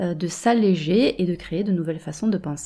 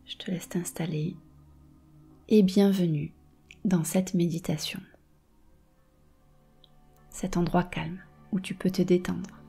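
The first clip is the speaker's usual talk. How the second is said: right in your ear. Acted. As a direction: breathe calm into every phrase. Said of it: "Je vais te guider dans une méditation".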